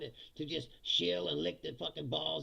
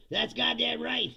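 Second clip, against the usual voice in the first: mock French defiance; 6 semitones higher, 6 dB louder, slower than usual. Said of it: "They go".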